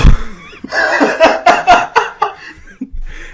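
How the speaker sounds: high, loud burst of laughter; big laugh. Real, not acted. Storyteller bursts out laughing at his own retelling. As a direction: crack up completely.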